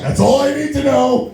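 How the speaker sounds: high, punchy mock delight; a touch of giddy glee; excited high quip. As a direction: toss it up high and pleased. His reaction quote.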